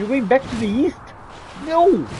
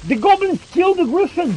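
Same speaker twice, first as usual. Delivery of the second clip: loud, high, thrilled call-out; can't believe what's happening on screen; excited; 6 semitones higher, 4 dB louder than usual. Real, not acted. Player shouting to the screen as goblins win, excited.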